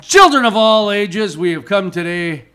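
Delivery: booming circus announcer intro; ringmaster. Pretend. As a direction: announce it like a big top ringmaster.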